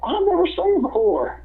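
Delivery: high, fake-innocent claim; mock-innocent Tommy. Tommy claims he never saw the hold, high mock-innocent voice. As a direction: pretend you know nothing, high.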